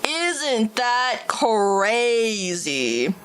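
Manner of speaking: Isn't that crazy stretched very slowly; very slow drawn out. Real, not acted. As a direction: drag out every word of Isn't that crazy. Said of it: "repeats line drawn out".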